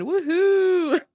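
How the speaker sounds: high, drawn-out whoop; mock cheer. Podcaster imitating her reaction to the ferry scene, cheering. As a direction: let out a celebratory high woohoo.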